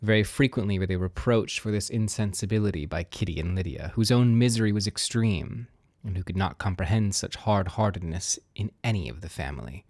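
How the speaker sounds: usual speaking voice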